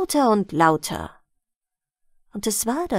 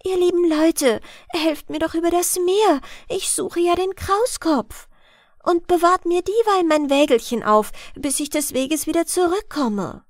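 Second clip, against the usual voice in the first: higher-pitched, earnest request; narrated plea from a little girl; pleading; 7 semitones higher than usual. A narrator's reading. Blondhärchen asks the people for help crossing the sea, pleading. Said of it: "Als sie dann bat".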